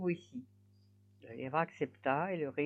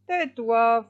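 loud, higher rebuke; curt husband's order; 4 semitones higher, 8 dB louder than usual. A narrator's reading. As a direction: shut her up firmly. Husband silences his wife. (ordre sec du mari, plus fort)

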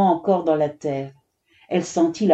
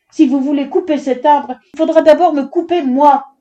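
high, loud, building defiance; defiant; 8 semitones higher, 5 dB louder than usual. A narrator's reading. Girl shielding her tree from the axe, defiant. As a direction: dare him, hitting the end harder.

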